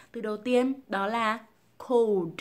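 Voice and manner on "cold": "cold" slow, stretched into a dark L; tongue-up cold. Shown as a demonstration. Teacher reviewing words, "cold" slowly with L. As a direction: draw out "cold" through the L. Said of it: "kéo lưỡi lên".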